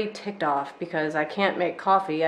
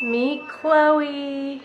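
playful intro; 8 semitones higher than usual. Real, not acted. Introducing a named fake plant.

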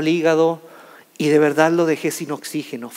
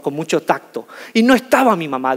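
ordinary talk, nothing marked

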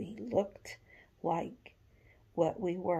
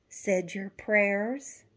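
slow, louder motherly question; slow mom voice; 2 semitones higher, 8 dB louder, slower than usual. A narrator's reading. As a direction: ask it slowly like a mother. Mom asks daughter.

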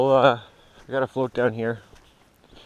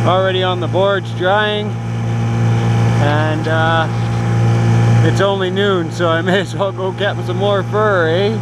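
loud, higher voice over engine noise; talking over noise; 4 semitones higher, 10 dB louder than usual. Real, not acted. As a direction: keep projecting over the motor. Talking over boat motor outdoors.

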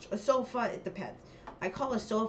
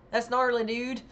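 louder, higher mock surfer-slang cheer; hammed-up slang exclamation; 5 semitones higher, 7 dB louder than usual. Shown as a demonstration. Trying out a regional slang phrase, hammed-up and loud. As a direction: call it out high and loud.

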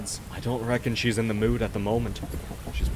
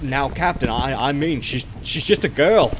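nervous plea; 3 semitones higher, 6 dB louder than usual. Acted. Sailor pleads with the captain.

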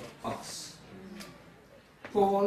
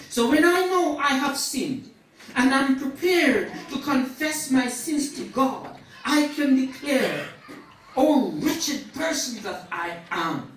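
raised, higher sermon voice; fervent preaching; 9 semitones higher, 12 dB louder than usual. Acted. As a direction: preach it out with rising force. Preaches at full force.

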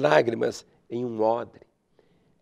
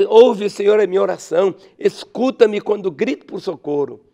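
loud cry for help; 5 semitones higher, 6 dB louder than usual. Acted. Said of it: "Por isso ele diz".

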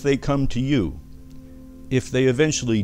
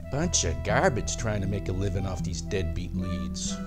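low, soft grumbling to oneself; disgruntled grumble; 4 semitones lower, 6 dB quieter than usual. Acted. Doctor Torpor alone after a failed sales call, grumbling. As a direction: complain under your breath, pitch dropped.

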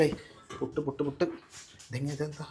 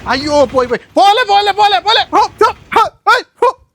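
much higher, loud calling out; shouting after; 14 semitones higher, 9 dB louder than usual. Acted. Father shouting after the departing fish seller, high and loud. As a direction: yell to stop the fish seller.

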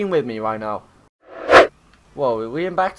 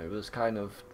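ordinary talk

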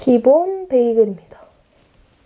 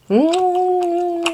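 savoring hum; 9 semitones higher, much slower than usual. Real, not acted. Savoring a bite.

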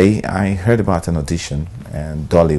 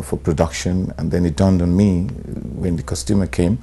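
ordinary delivery

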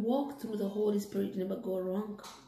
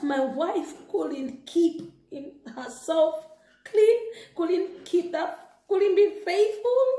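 bitter anger; 8 semitones higher, 4 dB louder, slower than usual. Acted. Husband's angry words to himself.

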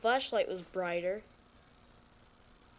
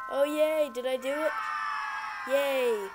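excited cheer; 3 semitones higher, 4 dB louder than usual. Real, not acted. Cheering after surviving.

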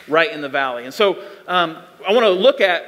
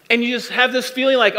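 ordinary talk, nothing marked

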